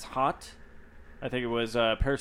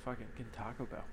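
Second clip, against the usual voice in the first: lazy hushed whisper; 2 semitones lower, 14 dB quieter than usual. Retold or imitated. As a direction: breathe it like a secret craving. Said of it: "I was just like".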